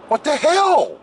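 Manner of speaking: high-pitched indignant outburst; exasperated disbelief. Real, not acted. Streamer about his blurry playback, exasperated. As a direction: squawk it in comic outrage.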